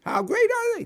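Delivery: high jokey asking voice; mock question. Shown as a demonstration. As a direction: demand an answer as if asking for numbers. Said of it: "That's what it would sound like".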